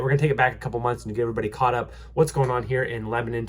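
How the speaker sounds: usual speaking voice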